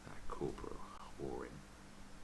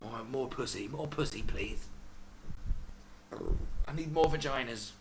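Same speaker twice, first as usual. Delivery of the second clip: higher, louder mock greedy whine; mock greedy man begging for more; greedy cheater voice; 8 semitones higher, 5 dB louder than usual. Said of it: "people cheat ... 'cause they're greedy".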